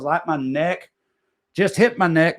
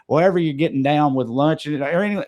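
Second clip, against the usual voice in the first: ordinary delivery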